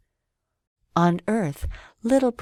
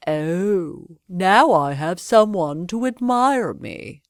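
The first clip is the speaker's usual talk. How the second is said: vain man; slower than usual. A narrator's reading. Vain man's voice.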